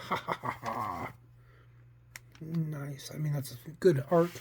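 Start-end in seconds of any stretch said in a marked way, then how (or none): 0.1-1.1 staged laugh falling away; acted mad laugh. Costa laughing at his own fear, acted cackle. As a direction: laugh it out, getting lower and softer. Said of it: "But as he stopped laughing".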